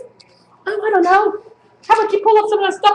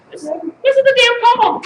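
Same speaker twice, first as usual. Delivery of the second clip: higher declaimed poem title; raised, projected recital voice; 5 semitones higher than usual. Acted. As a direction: announce the title like a performance poet.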